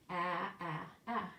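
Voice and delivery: "A, a, a" as the short a of apple; short a sound. Shown as a demonstration. Teacher models the short a sound. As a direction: repeat the short vowel "a" three times. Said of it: "If it makes a short sound like apple".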